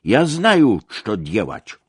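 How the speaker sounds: loud, high opening that drops away; defiant retort. A narrator's reading. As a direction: insist haughtily, hitting the first words. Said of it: "odparł hardo".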